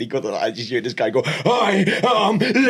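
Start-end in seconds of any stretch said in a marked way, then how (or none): 1.2-2.7 high, drawn-out mock outrage; livid shouting caller. Irate caller rings colleague's phone, imitated livid shout. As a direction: bellow it slowly like an irate customer, hammed up. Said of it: "he'd just shout at you".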